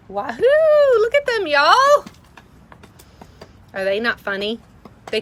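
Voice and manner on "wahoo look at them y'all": high, loud wahoo yell. Real, not acted. Cheering at the chickens.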